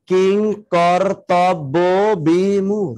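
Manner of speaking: কিং কর্তব্য বিমূঢ় spoken slowly, syllable by syllable; slow syllable count. Shown as a demonstration. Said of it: "correct উত্তর দেখেন". (ধীরে ধীরে অক্ষর গুনে বলা)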